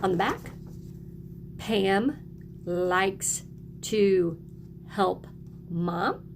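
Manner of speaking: normal talking voice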